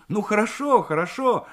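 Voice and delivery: high-voiced operator giving in; placating girl. A narrator's reading. Operator gives in to his pleading. (девичьим голоском, уступая)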